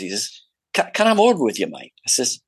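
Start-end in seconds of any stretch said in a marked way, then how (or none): 0.7-1.9 a hint of an Aussie accent, pitched higher; lightly voiced Australian director asking for a word; Aussie director impression. Director pulling the actor aside, in a light Aussie accent. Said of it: "I think he was a Brit".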